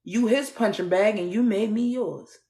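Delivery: ordinary talk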